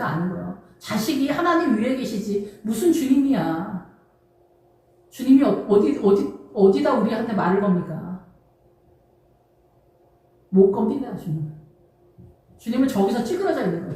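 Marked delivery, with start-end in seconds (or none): none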